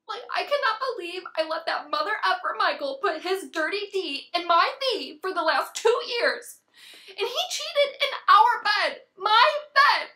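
loud, indignant rant building to a yell; outraged venting. Acted. Venting to her girlfriends about a cheating boyfriend, outraged. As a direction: rant furiously, hitting the final words hard.

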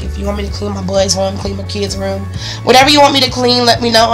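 ordinary talk, nothing marked

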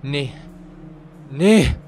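rising frightened no, no; scared. Real, not acted. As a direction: panic at the jumpscare. Jumpscare reaction.

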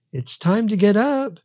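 put-on high, lilting child's voice; hushed calling out. A narrator's reading. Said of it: "called out in a hushed voice".

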